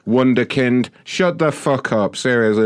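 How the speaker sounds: ordinary talk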